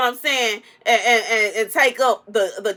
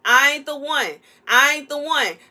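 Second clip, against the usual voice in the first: ordinary talk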